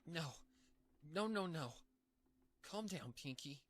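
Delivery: high pony voice, frightened; panicked self-calming. Acted. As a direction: try to talk yourself down mid-panic. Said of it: "Pinky began to panic".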